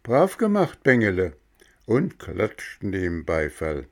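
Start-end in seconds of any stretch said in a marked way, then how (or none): 0.0-1.3 higher, louder cheer; a cart of boys applauding. Acted. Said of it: "Sie riefen".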